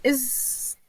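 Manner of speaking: halting, broken-off question; struggling for words. Acted. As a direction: stall after each word. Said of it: "she's struggling to find the words".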